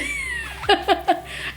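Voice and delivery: squeaky high giggle. Real, not acted. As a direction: giggle at yourself. Laughing at own self-praise.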